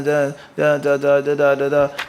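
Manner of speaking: halting, stuck on the word the; stumbling reader. Retold or imitated. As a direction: imitate a boring stammering khatib. Imitating a stumbling khatib.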